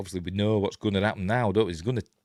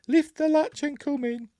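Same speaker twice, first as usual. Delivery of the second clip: very high put-on falsetto; light voice. Huntsman posing as Granny calls the girl in, light high voice. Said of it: "a light voice".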